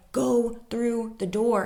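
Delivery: slow, measured command; calm deliberate go through the door; slow permission. Retold or imitated. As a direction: grant permission to go.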